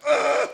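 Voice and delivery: a loud, high grunting noise; mock grunt. Mocking coffee buyers at Timmy's, a loud grunt. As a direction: grunt out the order like a machine. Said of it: "being like".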